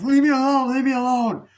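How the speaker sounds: very high, loud, overwrought cry; mock panicked scream. [name] pulls a knife on the bullies, mock panicked scream. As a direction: scream it in hammed-up desperation.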